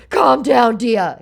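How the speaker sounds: high, loud mock soothing; mock scammer. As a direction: mimic his patronising calm down. Scammer quoted.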